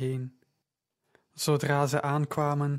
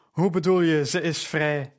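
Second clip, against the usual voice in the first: shouting; 2 semitones higher, 5 dB louder than usual. A narrator's reading. The girl's owner storms up, shouting. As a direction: bark the question at them. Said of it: "schreeuwde een man".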